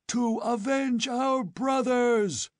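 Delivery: high, slow war howl. A narrator's reading. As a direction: howl for vengeance as a mob. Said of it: "they howled".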